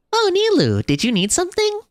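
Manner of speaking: very high falsetto character voice; game character voice. Acted. Asking Nilou what she needs, high falsetto. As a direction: greet her in a high falsetto. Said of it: "trying my best to voice all these different characters".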